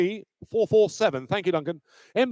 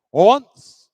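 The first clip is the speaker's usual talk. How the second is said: sharp loud call; loud auctioneer call; 3 semitones higher, 10 dB louder than usual. Real, not acted. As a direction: ring out the word to the room. Auctioneer calling the hammer.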